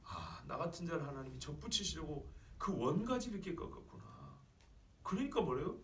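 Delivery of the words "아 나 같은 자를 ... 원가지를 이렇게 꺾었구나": warm, humbled realization. Acted. Preacher voicing a humbled grafted-in believer, warm realization. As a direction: read it gently, as it dawns on you. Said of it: "따뜻하게 읽을 수 있어요".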